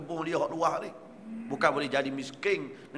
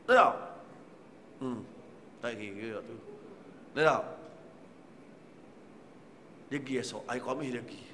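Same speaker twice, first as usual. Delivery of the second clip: ordinary talk, nothing marked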